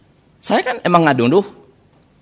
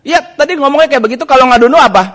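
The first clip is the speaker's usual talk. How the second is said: high, loud and rapid; mock a wife firing back; acted-out quarrelling, fast and raised; retold heated retort; 7 semitones higher, 7 dB louder, faster than usual.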